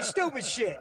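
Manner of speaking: normal talking voice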